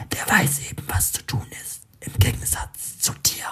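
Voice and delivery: cold, cynical whisper. A narrator's reading. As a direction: whisper it like a mocking inner voice. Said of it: "spricht die Stimme zynisch".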